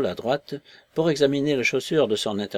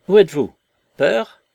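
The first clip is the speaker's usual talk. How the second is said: loud, high, challenging call; défi lancé fort; 8 semitones higher, 9 dB louder than usual. A narrator's reading. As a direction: challenge the hidden speaker loudly. Marvel se dresse et défie la voix. (apostrophe forte et indignée)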